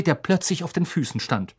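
ordinary delivery, nothing marked

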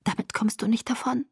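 soft, mostly voiceless whisper; whispered threat. A narrator's reading. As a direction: whisper the warning, barely daring. Obedient whispered threat continues. (leise geflüsterte Drohung)

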